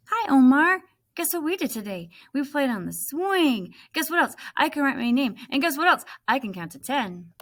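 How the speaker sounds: put-on high, eager little girl. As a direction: chatter like an excited small child. Little girl chattering to Omar.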